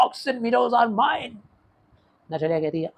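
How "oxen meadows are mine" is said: slow, drawn-out high shout. Acted. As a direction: yell the claim at her, much higher. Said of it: "चिल्ला के बोलता है". (ज़ोर से चिल्लाकर, धीमा और ऊँचा)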